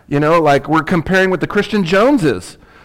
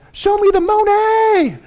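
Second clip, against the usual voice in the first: high, slow hammed-up squeal of a movie line; mock movie shout; slower than usual. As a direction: squeal it out like a goofy film quote. Joke voicing movie line.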